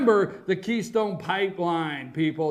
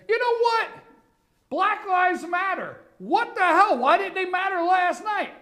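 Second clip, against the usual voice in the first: sarcastic outrage; 9 semitones higher than usual. Real, not acted.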